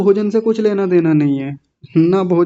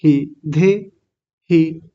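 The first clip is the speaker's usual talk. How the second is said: धे and ही slow, pulled apart; separated syllables; much slower than usual. Shown as a demonstration. Teacher showing how to break a word, slow separated धे ही. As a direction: break धे ही in two, drawing out each part. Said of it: "अलग अलग कर लें।".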